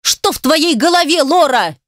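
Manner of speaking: high-pitched angry question; heated, disbelieving cry; exasperated outburst. A narrator's reading. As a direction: demand it in total exasperation. Exasperated man in a quarrel.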